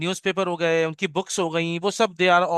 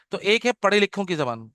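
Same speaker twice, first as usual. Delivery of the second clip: normal talking voice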